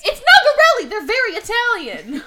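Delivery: loud, high-pitched protest; mock outburst. Host protests to co-host about the T-birds, shouting high.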